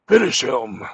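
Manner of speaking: loud booming announcer call; loud fighting game announcer. Retold or imitated. Mortal Kombat announcer.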